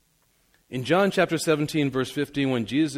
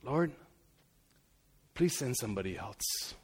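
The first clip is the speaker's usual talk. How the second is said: pleading softly; 3 semitones lower, 12 dB quieter than usual. Retold or imitated. Moses pleads.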